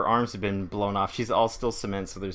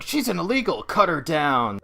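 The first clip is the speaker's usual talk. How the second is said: raised, higher retold command; mock soldiers' order; 5 semitones higher, 4 dB louder than usual. Soldiers around Sandman ordering an attack, mock raised command.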